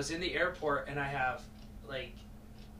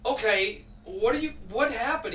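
higher and louder, urgent; alarmed retold question; 7 semitones higher, 7 dB louder than usual.

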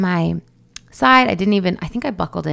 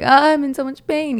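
high retold wail of pain; mock anguish; 8 semitones higher than usual. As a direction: act out complaining through labor pains. Retold complaint during contractions.

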